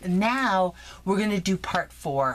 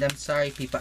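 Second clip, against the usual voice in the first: normal talking voice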